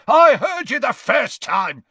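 loud, high irritable snap; snapping. Acted. Father interrupting the official, snapping. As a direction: cut in sharply and loudly. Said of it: "snapped Gaunt".

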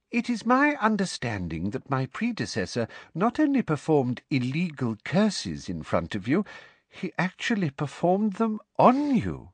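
high-pitched, sweetly officious lecturer; prim, disapproving professor. A narrator's reading. Professor telling her class about the predecessor, prim disapproval.

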